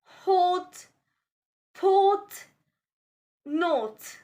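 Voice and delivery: "hot, pot, not" with slightly rounded O; rounded O sound. Shown as a demonstration. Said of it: "slightly round your lips".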